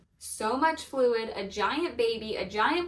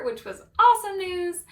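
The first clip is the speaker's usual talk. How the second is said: usual speaking voice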